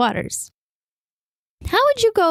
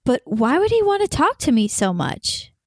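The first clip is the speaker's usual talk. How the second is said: usual speaking voice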